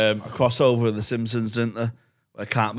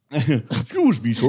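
slow, mock-polite Canadian request; polite Canadian baby; slower than usual. Baby asking permission to cry, slow mock-polite voice. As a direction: ask it slowly, like an overpolite baby. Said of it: "They'll ask you if they can cry".

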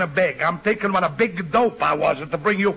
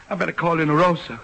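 usual speaking voice

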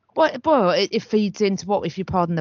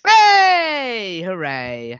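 loud cheer; 6 dB louder, much slower than usual. Real, not acted. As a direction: cheer out loud, pleased with the win. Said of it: "Sorry, that was quite loud".

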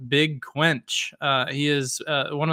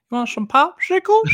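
put-on very high, creepy whistly old pervert; perviest voice; 13 semitones higher than usual. Asked to do the popsicles line on command, high pervy voice. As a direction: ask it in a high-pitched pervy voice. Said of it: "I gotta do it in the perviest voice".

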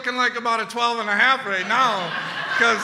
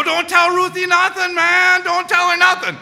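urgent plea; 8 semitones higher, 4 dB louder than usual. Retold or imitated.

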